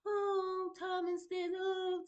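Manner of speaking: mock high-pitched swooning over him; fawning friend imitation. Friend fussing over her husband, mock fawning.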